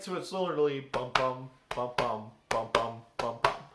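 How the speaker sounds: bum bum beaten out like a heartbeat; heartbeat rhythm. Shown as a demonstration. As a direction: tap out each bum as a steady pulse. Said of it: "meaning heartbeat".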